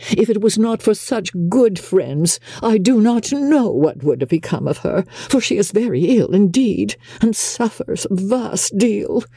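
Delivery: high-pitched, gushing gratitude; effusive mother. A narrator's reading. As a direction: pour out thanks in a high, fussy voice. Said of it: "profuse in her acknowledgments".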